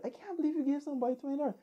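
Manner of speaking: put-on high, fast girlfriend in disbelief; upset girlfriend. Girlfriend scolding him over the twenty dollars, upset, high and quick. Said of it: "she was upset".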